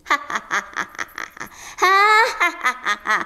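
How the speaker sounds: gleeful demon cackle; wicked ha ha ha cackle; evil laugh. Acted. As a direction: cackle like a delighted fox demon.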